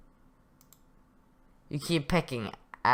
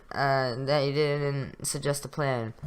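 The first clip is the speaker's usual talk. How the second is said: usual speaking voice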